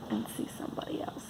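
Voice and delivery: soft whisper. Real, not acted. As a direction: whisper it aside.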